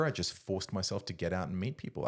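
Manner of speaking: ordinary talk, nothing marked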